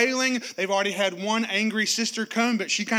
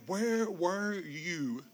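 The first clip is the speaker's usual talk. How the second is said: very slow, soft grieving question; hushed lament; 2 semitones lower, 8 dB quieter, much slower than usual. Acted. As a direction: let it ache, barely voiced. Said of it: "We've all prayed this prayer".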